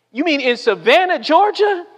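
acted disbelief, rising sharply at the end; incredulous question. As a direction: pitch climbing high on a disbelieving question.

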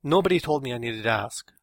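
fed-up indignation; disgusted. A narrator's reading. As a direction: protest it with disgust.

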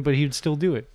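ordinary talk

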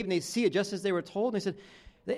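ordinary talk

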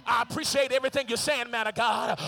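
loud, driving sermon climax; preacher urging the congregation to speak it aloud; preaching at full force. Acted. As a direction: push each line harder to the crowd.

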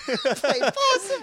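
high, laughing through the words. Real, not acted. Telling co-host about playing possum, laughing. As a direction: say it cracking up. Amused at own story.

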